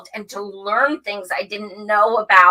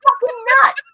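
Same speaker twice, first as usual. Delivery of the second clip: high, loud, fast protest; emphatic refusal; 12 semitones higher, 4 dB louder than usual. Retold or imitated. Telling Steve no on camera, loud high protest. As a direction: refuse him loudly, no way. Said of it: "And I was like".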